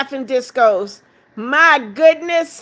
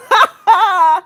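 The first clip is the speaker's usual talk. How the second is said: bubbling burst of laughter; amused laugh; 3 semitones higher, 4 dB louder than usual. Real, not acted. Counting [name]'s laughing discos, amused laugh. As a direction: laugh out in disbelief. Gift count keeps climbing.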